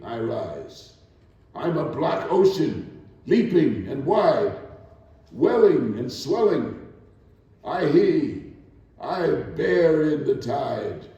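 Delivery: rising, declaiming poetry reading; spoken-word performance of Still I Rise; poem recitation. Acted. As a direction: recite it with building defiance and pride. Recites Maya Angelou poem.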